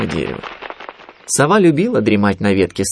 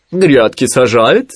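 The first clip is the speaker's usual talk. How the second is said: louder, drawn-out questioning; Owl character; 3 semitones higher, 6 dB louder, much slower than usual. A narrator's reading. Owl questioning Winnie about Rabbit, loud and slow. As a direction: stretch the question out loudly. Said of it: "спросила сова".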